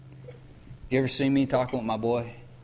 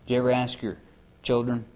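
normal talking voice

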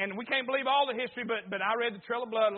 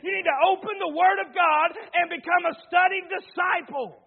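raised, driving preacher's call; fervent revival preaching, louder and higher; preaching at full force; 6 semitones higher, 4 dB louder than usual. Acted. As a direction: push it out to the pews. Preaching climax to congregation.

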